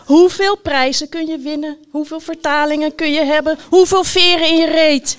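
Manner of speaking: high, loud exasperated questions, building; indignant rant. A narrator's reading. Knight rants about all those prizes, indignant.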